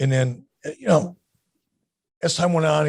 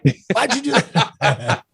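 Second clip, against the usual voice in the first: laughing mock outrage; 6 semitones higher, slower than usual.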